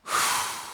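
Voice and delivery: long breathy sigh before starting; audible sigh. Shown as a demonstration. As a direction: let out a heavy exhale. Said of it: "that sigh of".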